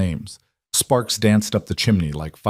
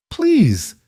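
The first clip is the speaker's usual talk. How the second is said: high cracked plea, higher pitch; voice cracking. A narrator's reading. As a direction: voice breaks on the word. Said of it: "she finally whispered, and her voice cracked".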